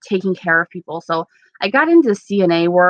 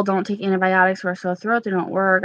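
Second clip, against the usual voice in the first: ordinary delivery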